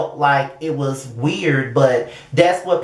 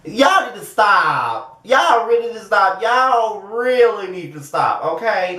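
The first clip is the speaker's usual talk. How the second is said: higher, drawn-out telling-off; exasperated scolding; 6 semitones higher, slower than usual. Real, not acted. Host telling biased viewers to stop, exasperated. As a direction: stretch the words in exaggerated exasperation.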